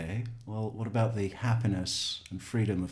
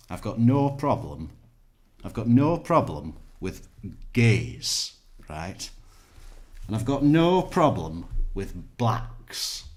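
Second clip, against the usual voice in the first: loud, slow northern English bigot; north of England accent; 2 semitones higher, 6 dB louder than usual. Acted. Comedy sketch bigot voiced in a north of England accent. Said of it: "if he's from the north of England".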